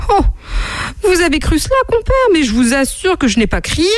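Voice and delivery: high-pitched protest of innocence; insist, voice jumping up high; insistent. Acted. Monkey assuring the camel he didn't shout, insistent. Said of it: "insista le singe".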